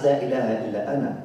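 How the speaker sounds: rushed, low recital of the ego's creed; mock ego creed. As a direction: rattle it off like the ego boasting. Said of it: "That voice within us is telling us".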